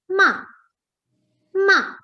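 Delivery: "ma" starting high and dropping sharply; fourth tone, falling. Shown as a demonstration. Teacher shows student the fourth tone, falling "ma". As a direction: drop the pitch fast on a short "ma". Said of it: "the fourth tone is the falling tone".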